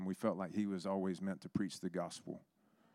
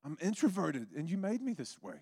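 high-pitched, defensive whine to God; protesting complaint; 8 semitones higher than usual. Retold or imitated. Preacher retelling his old excuses to God, complaining.

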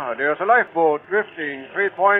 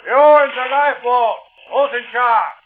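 high, raised hail to a distant boat; calling across water; 10 semitones higher, 4 dB louder than usual. Acted. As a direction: shout your questions over to the lifeboat. Captain hails men in lifeboat.

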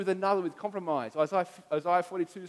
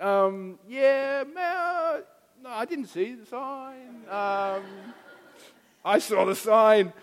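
high, slow, nervous excuse to a cop; sheepish excuse; 4 semitones higher, 4 dB louder, much slower than usual. Retold or imitated. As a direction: stall like you're caught out. Sheepish answer to a policeman.

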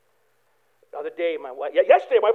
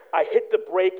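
ordinary talk

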